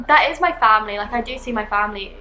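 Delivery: normal talking voice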